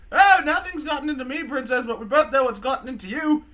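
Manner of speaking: put-on high, loud, catty drunken British robot; mean drunk rant, building to an outburst; drunk C-3PO. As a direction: sneer accusations like a sloshed British droid. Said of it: "three P O's just a mean fucking drunk".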